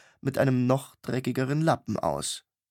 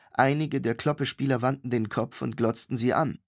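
ordinary talk

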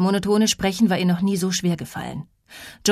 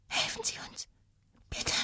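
put-on faint childlike whispered plea; thin, unsure whisper; 14 dB quieter than usual. A narrator's reading. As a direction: whisper very softly, like an unsure child far away. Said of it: "So dünn, so weit weg, so unsicher, wie ein Kind". (ganz leise, dünn und unsicher geflüstert)

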